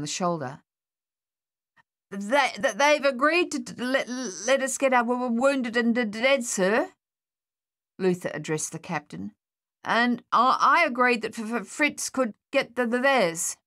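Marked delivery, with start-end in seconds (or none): 2.1-6.9 higher, halting stammer; nervous stammer. A narrator's reading. Soldier stammering his report to the captain. 9.8-13.6 higher, halting stammer; nervous stammer. A narrator's reading. Soldier stammering his report to the captain.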